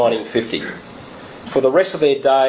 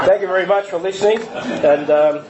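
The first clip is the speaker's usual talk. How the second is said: laughing; 6 semitones higher than usual. Real, not acted. Laughing through closing thanks.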